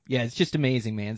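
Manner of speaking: usual speaking voice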